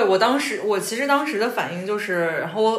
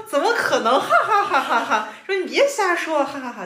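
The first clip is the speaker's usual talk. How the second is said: mocking laugh; 6 semitones higher than usual. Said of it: "然后他说".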